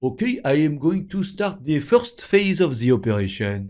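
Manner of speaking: a hint of the doctor, slow and calm in English; deep doctor voice. Retelling the surgeon announcing the first phase, slow and calm. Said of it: "il a une voix très grave".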